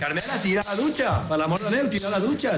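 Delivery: put-on high, exasperated shout at the kids; retold furious yell. Father yelling at his kids to get in the shower, exasperated shout. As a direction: bellow it like a father at the end of his rope.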